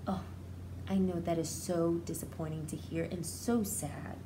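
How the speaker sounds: ordinary delivery, nothing marked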